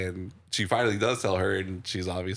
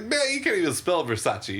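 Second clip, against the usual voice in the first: high taunting voice; quoting the comeback in a high voice; mock comeback; 10 semitones higher than usual. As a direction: jab back pitched way up. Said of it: "And he's like".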